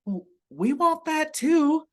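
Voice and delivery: high-pitched, badly faked British accent; hammy attempt at sounding British; horrible British accent. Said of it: "that was a really horrible accent".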